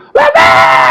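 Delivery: imitated desperate screaming at the door; retold shriek to get in; woman yelling outside. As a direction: scream it as she bangs harder. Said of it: "dia tambah gebrak lagi".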